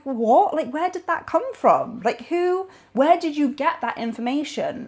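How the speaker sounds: high, disbelieving what-are-you-talking-about; incredulous. Retold or imitated. Reacting to a bogus viral health post, high and incredulous.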